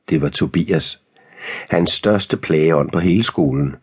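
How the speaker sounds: ordinary delivery, nothing marked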